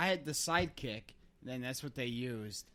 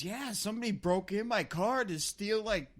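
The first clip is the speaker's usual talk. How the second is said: higher-pitched, swooping aggrieved complaint; retold rant; 5 semitones higher, 4 dB louder than usual. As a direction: imitate a friend launching into a rant. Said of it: "he starts going off on this rant".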